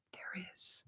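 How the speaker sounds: hushed whisper, like a secret; whispered reveal. Shown as a demonstration. Teasing viewers about nonverbal cues online, in a secret whisper. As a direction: let the answer slip out in a whisper. Teasing her research finding.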